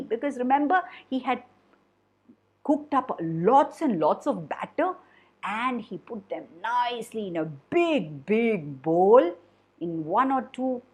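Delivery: ordinary delivery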